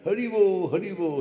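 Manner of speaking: joyful Hari Bo exclamation; joyful cry. Retold or imitated. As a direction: cry out in delight. Happy Brahmin rejoicing.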